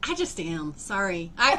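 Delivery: ordinary talk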